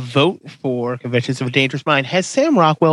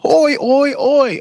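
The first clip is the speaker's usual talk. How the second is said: mock Australian; 12 semitones higher, much slower than usual. Host botching a British impression with Aussie oi, high. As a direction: bark oi oi like a fake Aussie. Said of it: "that's Australian".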